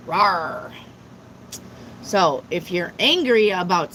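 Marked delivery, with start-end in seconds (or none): 0.1-0.7 a small mock roar, lower than her talk; cute pretend dragon growl; playful dragon rawr. Reading the dragon message.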